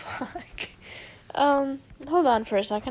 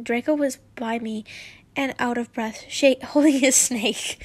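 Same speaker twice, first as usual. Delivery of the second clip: ordinary delivery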